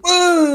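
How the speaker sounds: high baffled "woo"; high mock-confused hoot; confused waiter's "woo". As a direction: imitate his bewilderment in a high squeal. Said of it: "He was so confused".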